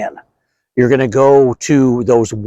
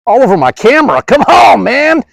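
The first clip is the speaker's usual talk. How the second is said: rising, louder, ending in a shout; real exasperated outburst; 9 semitones higher, 4 dB louder than usual. Real, not acted. Pest guy yells as dust covers his camera, rising to a shout. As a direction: caught off guard, yell at the mess.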